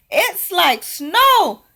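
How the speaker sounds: put-on high, slow childlike marvel; children's wonder. Children marvel at popcorn falling, high slow wonder. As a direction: sound like delighted kids. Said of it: "the children shouted".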